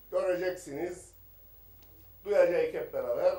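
ordinary talk, nothing marked

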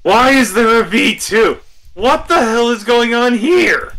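high, loud disbelief; shocked outburst. Real, not acted. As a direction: blurt it out in shock. Shocked at card in deck.

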